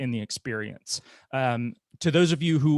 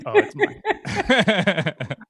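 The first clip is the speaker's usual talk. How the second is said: higher, louder, slow, laughing; amused laughing realization; 9 semitones higher, 6 dB louder, much slower than usual. Retold or imitated. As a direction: break into laughter while saying it. Laughs at own recorded voice.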